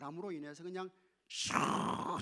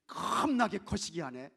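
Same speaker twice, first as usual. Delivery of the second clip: loud, slow Jeolla imitation; Jeolla dialect; 3 semitones higher, 8 dB louder than usual. Preacher imitates Jeolla people for the congregation, Jeolla dialect. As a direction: stretch out 겁나게 like a Jeolla local. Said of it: "전라도 사람들이 이렇게 이야기를 해요".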